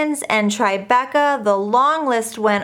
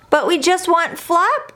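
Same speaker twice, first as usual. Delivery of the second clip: put-on pleading children; 7 semitones higher than usual. A narrator's reading. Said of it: "cried May and cried Joe".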